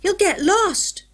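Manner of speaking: slow, high, loud call; boy shouting a warning down the tunnel; yell. A narrator's reading. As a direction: shout the warning after him. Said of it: "he yelled".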